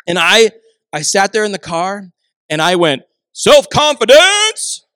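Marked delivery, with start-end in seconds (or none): 3.3-4.8 high, loud, stretched-out singsong; triumphant announcement. Retold or imitated. Dad presents his son's promised gift, triumphant singsong. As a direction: proclaim it like a grand prize. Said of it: "I kept saying it different ways".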